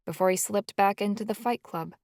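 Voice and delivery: usual speaking voice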